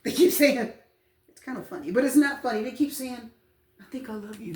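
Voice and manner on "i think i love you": very soft imitated love confession; hesitant hushed confession. Tarot reader quoting someone in love, hushed. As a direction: drop the volume right down, imitating a timid admirer. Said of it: "they're kind of talking like that".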